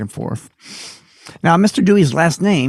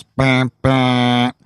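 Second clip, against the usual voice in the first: imitated train horn on a level note; two horn blasts. Engineer crossing the road, imitated two-blast horn. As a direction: give two honks like a train. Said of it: "he always did two blasts".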